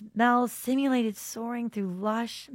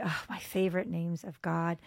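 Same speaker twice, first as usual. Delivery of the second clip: usual speaking voice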